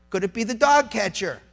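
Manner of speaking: ordinary talk, nothing marked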